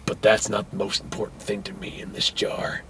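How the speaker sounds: low, near-whispered solemn voice; mock-profound soft murmur; hushed earnest murmur. Joe tells the girl about the jar, hushed earnest.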